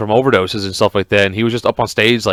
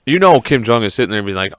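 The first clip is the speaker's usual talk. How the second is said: usual speaking voice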